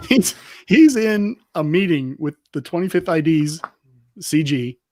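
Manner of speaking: ordinary delivery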